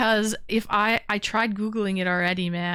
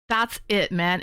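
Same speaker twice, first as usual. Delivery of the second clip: ordinary talk, nothing marked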